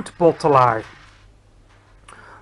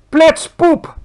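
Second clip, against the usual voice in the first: very high, loud giant yell; screamed insult; 11 semitones higher, 10 dB louder than usual. A narrator's reading. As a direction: explode with the insult at full volume. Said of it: "schreeuwde de bloedbottelaar".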